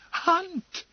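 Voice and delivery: very high, startled cry; horrified cry. Acted. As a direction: cry out in alarm. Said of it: "cried Emily".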